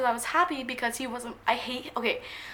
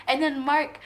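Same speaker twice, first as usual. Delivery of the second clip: ordinary delivery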